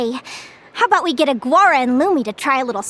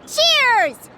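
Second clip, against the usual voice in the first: cheerful toast; 9 semitones higher than usual. Real, not acted. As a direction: raise your voice high for a happy toast. Toasting the celebration with friends.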